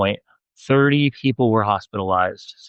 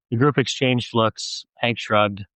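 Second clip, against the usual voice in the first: usual speaking voice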